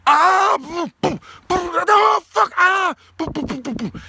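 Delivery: high yelled cry then boom boom crash sounds; crash noises. Retold or imitated. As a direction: act out a man tumbling with shouted booms. Imitates Philly crashing behind him.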